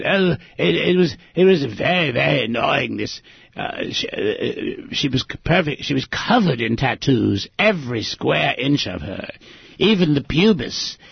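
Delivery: put-on high, fussy old gentleman voice; Don impression. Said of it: "I'll try to do my Don impression".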